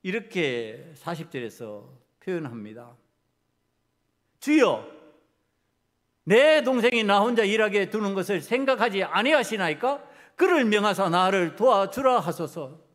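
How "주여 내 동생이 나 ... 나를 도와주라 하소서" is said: loud, heated appeal; angry plea. Acted. Martha asks the Lord to make her sister help, angrily. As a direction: push the plea out loudly, with indignation. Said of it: "그 화나는 것을".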